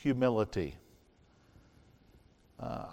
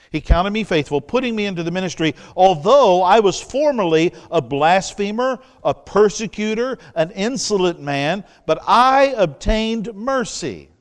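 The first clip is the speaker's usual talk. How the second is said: higher, louder preaching read; fervent scripture reading; 5 semitones higher, 7 dB louder than usual. Acted. Preacher proclaiming Paul's words to the church, fervent and loud. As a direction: proclaim the verse with rising fervor.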